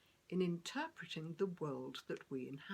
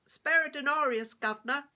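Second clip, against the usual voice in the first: louder, higher beggar's cry for coins; calling out for alms; 10 semitones higher, 13 dB louder than usual. Retold or imitated. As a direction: call out to the passing crowd for a coin.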